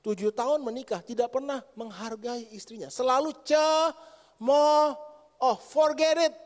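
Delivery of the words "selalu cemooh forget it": loud and slow, preaching hard; emphatic sermon shout. Real, not acted. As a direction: slam it on each word, slowly. Preacher declaring the husband's fault. (seruan khotbah yang keras dan lambat)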